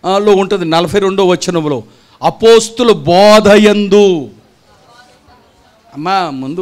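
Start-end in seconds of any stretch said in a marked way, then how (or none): 2.2-4.3 slow scripture reading. Acted. Reading the verse aloud.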